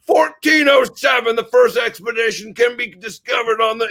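a booming, hammed-up history professor launching into a lecture; mock lecture. History professor bellowing to his class, hammed-up lecture. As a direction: shout the date like an old lecturer opening class.

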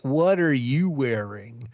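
slow, sleazy bad American accent; hammy American accent, drawn out. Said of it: "I was trying to do a bad American accent".

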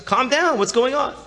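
high-pitched, urgent retold plea; urgent pleading. Woman to her hysterical sister on the phone, urgent.

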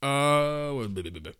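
bored mocking drone, trailing off; dismissive blah blah. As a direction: brush it off as noise. Mocking Ted Cruz's shots.

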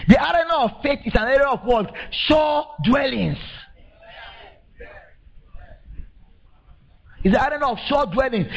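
high, ringing preacher call; fervent sermon climax; full-force preaching. Acted. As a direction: proclaim it to the church.

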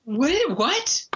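high-pitched disbelieving what; incredulous. Real, not acted. Disbelief at Bean Dad story.

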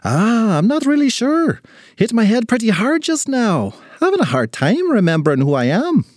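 high-pitched befuddled little man; dazed little leprechaun. Acted. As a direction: puzzle aloud after a bump on the head.

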